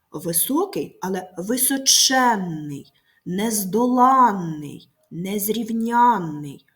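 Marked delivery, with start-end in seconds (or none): 1.4-2.9 височенний with a long stressed ЕНН; височенний said slowly, leaning on ЧЕН; stressed suffix. Shown as a demonstration. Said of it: "наголошені суфікси". 3.2-4.9 нездоланний with a loud, long ЛАН; slow нездоланний, stress late on АНН; stressed suffix. Shown as a demonstration. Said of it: "наголошені суфікси". 5.1-6.6 незрівнянний with a long stressed НЯН; slow незрівнянний, weight on the ЯНН suffix. Shown as a demonstration. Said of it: "наголошені суфікси".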